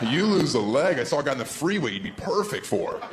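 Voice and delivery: ordinary talk, nothing marked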